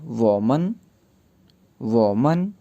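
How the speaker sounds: वोमन said slowly as wo-men, rising at the end; teacher models वोमन syllable by syllable for students; Chinese word demo. Shown as a demonstration. Teacher modeling वोमन for the class, careful pronunciation. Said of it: "इसके एंड पे मन लग जाएगा".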